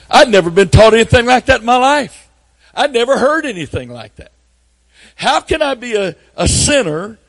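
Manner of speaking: high, loud preacher's cry of amazement; preaching at full force. Acted. Preacher to his congregation, amazed: high full-force shout. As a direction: shout the wonder out to the church.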